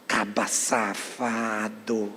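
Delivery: very low, slow, soft mock contempt; deep hushed aside of disgust; muttered disapproval. Real, not acted. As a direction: growl it quietly, drawing out each word. Muttered verdict on the brother.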